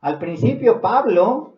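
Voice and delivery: loud, high pulpit reading; emphatic scripture preaching. Real, not acted. Preacher reading Acts to the congregation, loud and emphatic. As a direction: proclaim the verse, stretching the key words.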